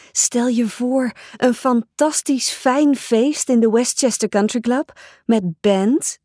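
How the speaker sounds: usual speaking voice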